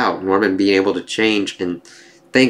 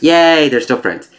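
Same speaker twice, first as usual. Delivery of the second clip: drawn-out, higher-pitched little hooray; mock cheer; 5 semitones higher, 4 dB louder than usual. Reader cheering that they stay friends, playful drawn-out mock cheer. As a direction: stretch the cheer out, playfully louder.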